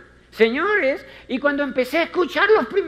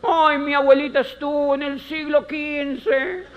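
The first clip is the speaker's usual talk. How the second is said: higher, whiny listing of excuses; 5 semitones higher than usual. Retold or imitated. A hint of a doubtful recruit listing relatives' failures.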